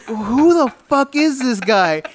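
high, slow, drawn-out disbelief; incredulous. Retold or imitated. Friend asking about the stranger who burst in, slow incredulous.

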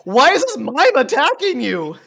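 high, loud disbelieving cry; incredulous mock outrage. Mocking the movie, she cries out in loud disbelief. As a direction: act baffled that a mime attacks.

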